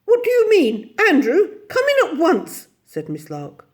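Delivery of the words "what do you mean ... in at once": high, loud scandalised scolding; shrill-pitched indignant order; indignant command. A narrator's reading. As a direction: demand obedience, outraged. Miss Lark scolds Andrew.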